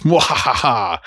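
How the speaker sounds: evil mwahaha laugh; gleeful villain cackle; mad scientist cackle. Pretend. Evil laugh after Wanda quote.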